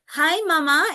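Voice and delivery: high singsong hello to parents; lilting, friendly greeting; modeled greeting. Retold or imitated. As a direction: re-enact greeting a family for a child. Re-enacts greeting mama.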